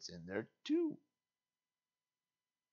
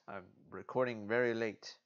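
usual speaking voice

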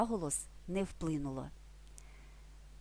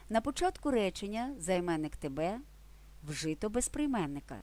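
ordinary talk, nothing marked

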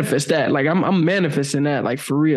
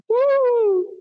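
high "woo". Acted. Overwhelmed reaction to candid talk.

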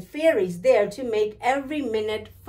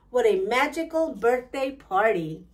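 usual speaking voice

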